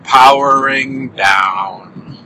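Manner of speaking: very slow, drawn-out robot shutdown; a sad robot winding down; robot powering down. Pretend. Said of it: "robots sound so sad when powering down".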